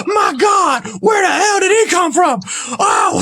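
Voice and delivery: high-pitched shouting commentator, utterly stunned; frantic Jim Ross. Retold or imitated. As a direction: cry out in hammed-up ringside shock. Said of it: "I gotta say it like this".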